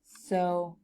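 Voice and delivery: normal talking voice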